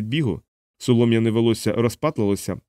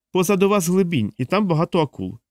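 higher-pitched character voice calling a warning; a hint of urgency, pitched up; lightly voiced Moro figure; 7 semitones higher than usual. A narrator's reading. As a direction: lift the voice to warn.

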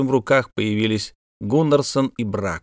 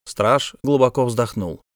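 plain reading voice

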